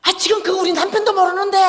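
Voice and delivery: imitated high, loud panicked fury; angry protest. Friend whose husband doesn't know, angry protest. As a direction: yell it back as the outraged friend. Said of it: "막 이러고 화를 내는 거예요".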